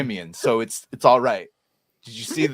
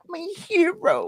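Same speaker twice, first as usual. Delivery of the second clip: much higher doting squeal; swooning high voice. Pretend. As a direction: gush it like a lovestruck fan.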